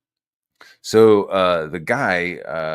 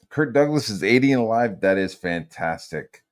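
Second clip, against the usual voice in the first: ordinary talk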